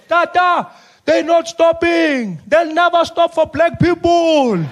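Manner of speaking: high, slow panicky plea; panicked high voice. Acted. Aide panicking to Mandela that nobody stops, high and drawn out. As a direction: fret high and slow at the old man.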